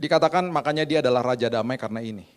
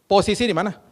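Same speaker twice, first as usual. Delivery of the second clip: loud, high, curt demand; mock-fierce questioning, high and loud; fierce housekeeper; 7 semitones higher, 7 dB louder than usual. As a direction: imitate a bossy housekeeper on the phone. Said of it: "dia gagah-gagah galak".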